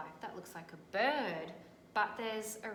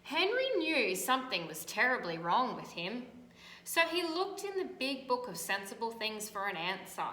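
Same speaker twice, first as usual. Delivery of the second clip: louder, animated read-aloud; storybook reading; 5 dB louder than usual. A narrator's reading. Teacher reading to children, animated storytelling. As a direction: make the tale vivid for kids.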